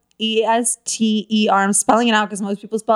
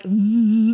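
buzzing like an ordinary vibrator. Retold or imitated. Comedian showing friends how normal vibrators sound, imitated buzz. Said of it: "normal vibrators are like".

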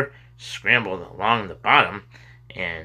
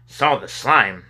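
usual speaking voice

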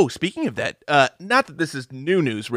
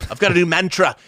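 a hint of Lennon; [name] impression; 3 semitones higher than usual. Mocking [name] with a light Liverpool accent. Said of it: "That's [name]".

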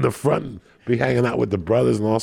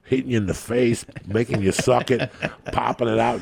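ordinary talk

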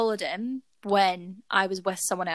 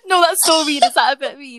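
giggling, louder protest; laughing; 5 semitones higher, 6 dB louder than usual. Real, not acted.

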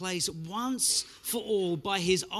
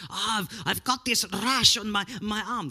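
put-on a Greek auntie line that drifts into a Russian accent, pitched a bit high; accidental Russian accent; 4 semitones higher, 4 dB louder than usual. Preacher voices the Greek auntie's complaint, slipping into Russian accent. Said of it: "That was more Russian than Greek".

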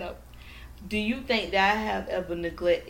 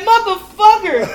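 pissed off; 9 semitones higher, 12 dB louder, slower than usual. Retold or imitated. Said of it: "I was so fucking pissed".

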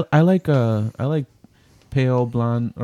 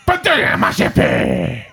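fake French; 5 semitones higher, slower than usual. Shown as a demonstration. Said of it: "I sound just like your ancestors".

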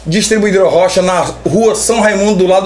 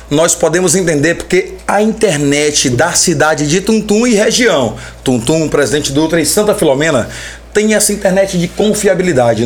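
lower, steady sponsor plug read; infomercial; 4 semitones lower than usual. Acted. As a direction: read it like a radio ad. Host reads sponsor plug. (locução de comercial)

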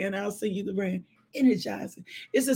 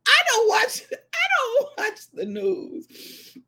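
high, drawn-out disclaimer; comic protest; 12 semitones higher, slower than usual. Real, not acted. Telling viewers of mother's news bulletin, high comic protest. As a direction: pitch it up and stretch it out.

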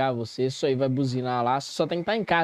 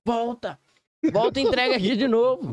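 calling out; 7 semitones higher than usual. Retold or imitated. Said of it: "O nego tava assim".

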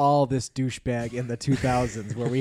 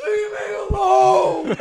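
mock shout; 7 dB louder, much slower than usual. Laughing mock protest.

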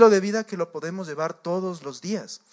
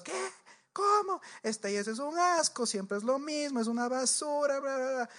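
higher-pitched, softer mock outrage; the angry lady storming off, hammed up; mock outraged rant; 8 semitones higher, 7 dB quieter than usual. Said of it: "Se fue vociferando". (despotricar indignada, aguda pero sin gritar)

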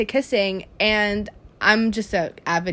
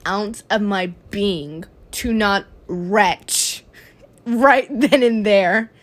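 slow emphasis; slower than usual. Real, not acted. Dramatic retelling.

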